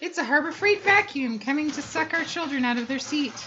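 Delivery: high, loud mock alarm; excited. Real, not acted. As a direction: sound the alarm, playfully. Jokes loudly about the vacuum.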